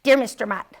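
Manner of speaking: plain reading voice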